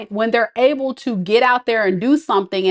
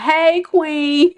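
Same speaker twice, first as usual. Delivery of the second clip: higher, mock smooth-talking suitor; hammed-up flirty guy greeting; 6 semitones higher than usual. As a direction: tease a cheesy pickup line. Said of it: "folks talking about".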